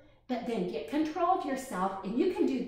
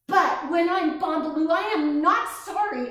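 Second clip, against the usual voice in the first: angry; 5 semitones higher, 8 dB louder than usual. Acted. Angry child's inner monster.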